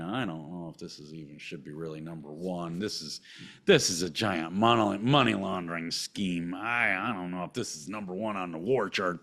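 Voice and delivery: low, hushed, doubtful aside; skeptical aside. Real, not acted. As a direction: drop right down into a quiet confession. Doubting aside about the ranking.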